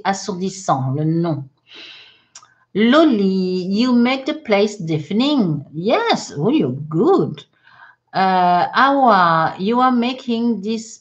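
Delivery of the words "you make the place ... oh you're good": ordinary delivery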